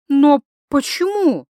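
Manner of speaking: slow, loud, high question; pleading protest. Acted. Wife asks why husband is called bad, slow protesting plea. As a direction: stretch it out in distressed disbelief.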